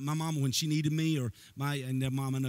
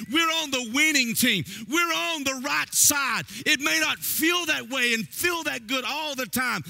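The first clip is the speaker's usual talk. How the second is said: much higher, louder shouted preaching; preaching at full force; 12 semitones higher, 7 dB louder than usual. Acted. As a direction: shout the victory to the congregation.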